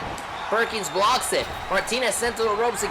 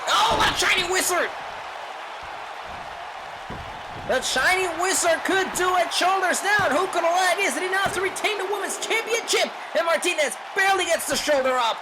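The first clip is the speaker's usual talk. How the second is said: excited shout; 10 semitones higher than usual. Real, not acted. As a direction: yell it like the title's on the line. Near-fall finisher call.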